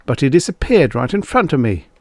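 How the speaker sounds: anxious pleading. Acted. Engel pleads with the cop.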